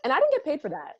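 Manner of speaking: amused. Real, not acted. Joke after laughing.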